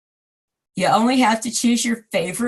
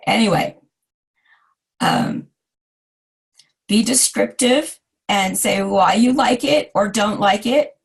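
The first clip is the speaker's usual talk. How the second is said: ordinary delivery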